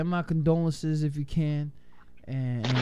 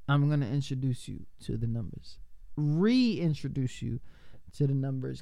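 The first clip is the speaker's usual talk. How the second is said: usual speaking voice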